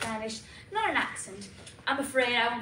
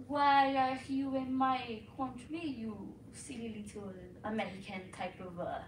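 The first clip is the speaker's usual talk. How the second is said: French accent; 8 dB quieter than usual. Shown as a demonstration. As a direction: sneer down at them in a French accent. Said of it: "The French accent is similar to London".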